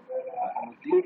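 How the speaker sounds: high, loud call of the name. Real, not acted. Teacher calling the next student over the class call.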